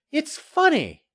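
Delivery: high, innocent-sounding character voice; woman reporter. A narrator's reading. Reporter needling the developer, high and innocent. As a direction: muse aloud in a high voice. Maxine feigns innocence to Murtaugh.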